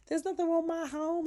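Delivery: high-pitched mock indignation; indignant denial. Mother defending her home to the child's father, indignant. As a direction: protest it high, imitating her.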